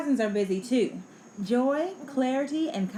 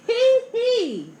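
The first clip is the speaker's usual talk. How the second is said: loud, high-pitched hee-hee giggle; playful squeal of giggling; 13 semitones higher, 9 dB louder than usual. A narrator's reading. Child giggles at waves.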